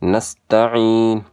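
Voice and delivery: slow, drawn-out نستعین with throaty ain; correct ain. Shown as a demonstration. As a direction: hold the final "ee" of نستعین after a clear ain. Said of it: "یہاں اس طرح پڑھی جائے گی".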